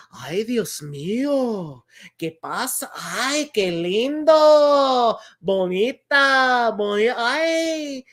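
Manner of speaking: put-on slow, drawn-out old lady cooing in Spanish; grandma thrilled opening presents; delighted grandma. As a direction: coo over the gifts like grandma.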